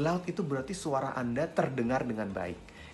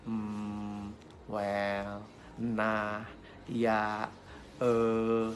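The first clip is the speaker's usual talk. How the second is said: low, drawn-out hesitation sounds; mock hesitant pauses between fillers; filler words; 3 semitones lower, much slower than usual. Shown as a demonstration. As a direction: stall on each filler, level and lingering. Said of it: "banyak filler words".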